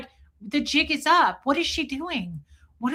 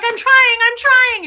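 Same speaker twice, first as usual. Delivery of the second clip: high, loud, strained pleading; mock desperate; 13 semitones higher, 12 dB louder, slower than usual. Marilyn pleading while deleting her videos, high mock strain. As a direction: squeal it like you're overwhelmed. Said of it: "she's like".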